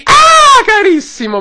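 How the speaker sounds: high screechy squeal of delight; very high, loud shrill cry. Retold or imitated. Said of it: "Con questa voce stridula".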